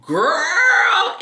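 put-on GIRL held long, high and loud; high, drawn-out exclaimed GIRL; signature GIRL. Shown as a demonstration. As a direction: sweep GIRL way up and stretch it. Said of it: "Y'all say that I say".

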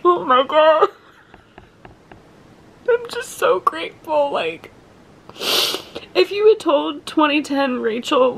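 high, teary voice, close to sobbing; tearful, crying. Real, not acted. Fan tells viewers she's sobbing over [name], high tearful voice. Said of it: "I'm gonna start crying again".

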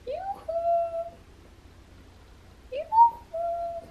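high falsetto sing-song yoo-hoo; calling someone home. Real, not acted. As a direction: call out high and playful. Calling hiding daughter.

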